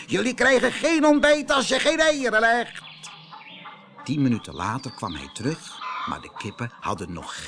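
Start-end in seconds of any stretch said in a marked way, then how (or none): 0.1-2.8 high, loud, fast demand; shouting. Acted. Rooster yells at the hens for eggs, high and fast. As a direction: yell for the eggs.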